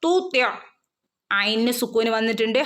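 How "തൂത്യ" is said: തൂത്യ with a full, raised ta sound; high, full തൂത്യ; heavy ta. Shown as a demonstration. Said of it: "half സുക്കൂന് കൊടുക്കുക ട്ടോ". (ത്വ ഉയർത്തി തൂത്യ)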